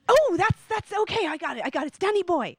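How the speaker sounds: high, eager "got it"; excited recognition. Retold or imitated. Uncle recognising the song.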